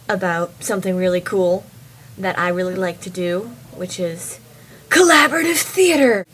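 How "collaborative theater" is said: high, loud, gleeful reveal; excited announcement. Real, not acted. Revealing something really cool she loves, excited loud cry. Revealing her favourite thing to do.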